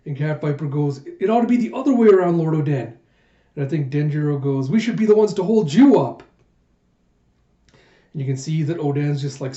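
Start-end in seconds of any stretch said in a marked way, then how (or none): 1.2-2.9 retold protest. Vassal protests to his lord. 4.7-6.2 raised, higher retold objection; earnest protest. Vassal insists to his lord they should carry him, earnest protest.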